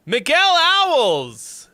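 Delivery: high, drawn-out shout of the name; excited shout. Real, not acted. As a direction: hype call-out to a donor. Thanking a donor, calling out.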